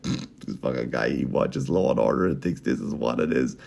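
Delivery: low, quiet imitated grumble; muttered disdain. Prosecutor fed up with the amateur lawyer, low mock mutter.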